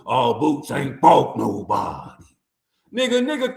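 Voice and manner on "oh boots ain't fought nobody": mocking critics. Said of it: "You said the same shit".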